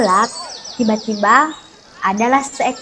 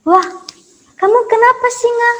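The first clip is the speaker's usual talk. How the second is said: high, concerned mouse voice; surprised mouse; 11 semitones higher, 3 dB louder than usual. Acted. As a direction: ask the trapped lion in surprise.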